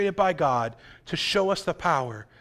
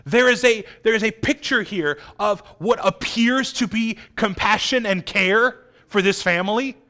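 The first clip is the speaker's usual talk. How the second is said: ordinary talk, nothing marked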